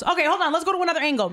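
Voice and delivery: lightly voiced king trying again; eager king. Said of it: "the king goes".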